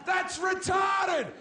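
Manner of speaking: hammed-up anguished outburst; mock despairing shout. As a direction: bellow the self-pity for laughs. Self-mocking rant about dreams.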